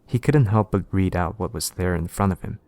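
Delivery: ordinary delivery, nothing marked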